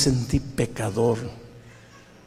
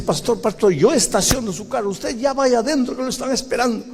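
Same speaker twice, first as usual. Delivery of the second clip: urgent church elder; 10 semitones higher than usual. Retold or imitated. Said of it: "me dice".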